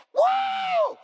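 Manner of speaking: high-pitched drawn-out whoop; retold shout. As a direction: re-enact a sudden whoop, sailing up high. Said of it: "And I just shouted".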